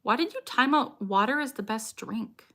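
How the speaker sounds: ordinary talk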